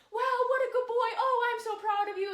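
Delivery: put-on high, loud, delighted praise for a good boy; gushing praise. Shown as a demonstration. Trainer rewards her dog Epic with high, loud gushing praise. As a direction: make a big deal of him, all joy. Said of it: "I might go".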